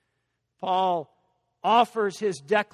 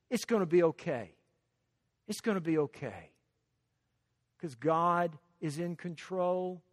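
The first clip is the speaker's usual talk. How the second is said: calm reassurance; 2 semitones lower, 4 dB quieter than usual. Acted. Preacher voicing inner peace to believers, calmly reassuring. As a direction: drop from proclamation into quiet comfort.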